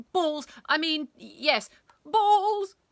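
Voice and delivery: high, loud, helpless slips; agitated teacher losing control; flustered blurting. A narrator's reading. Teacher cannot stop blurting word.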